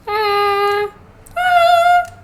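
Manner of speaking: high, thin cat meow; imitating cat meow. Streamer showing how her cat meows. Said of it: "That's what my cat's meow sounds like".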